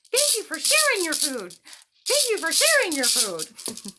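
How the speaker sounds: high, loud thankful grasshopper; grateful grasshopper. Acted. Grasshopper thanking the ants, high and loud. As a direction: thank them brightly. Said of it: "And he said".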